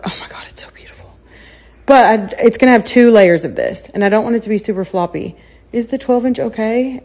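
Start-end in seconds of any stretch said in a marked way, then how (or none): 0.0-1.1 hushed, breathless whisper of delight; awed whisper. Real, not acted. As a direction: whisper as if it might break. Awed whisper over the decoration.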